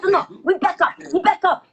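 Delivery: put-on high, insistent Japanese accent. Japanese passenger arguing with the pilot, high accented voice. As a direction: imitate a stubborn Japanese woman, pitched high. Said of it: "She goes".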